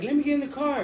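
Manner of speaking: high, urgent mock plea; imitated pleading woman. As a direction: imitate a woman begging for a ride.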